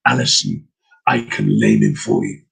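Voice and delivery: very low, menacing dog voice. Acted. Demon dog tempting the girl, very low menace. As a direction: growl an offer from deep down. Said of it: "and the dog talked".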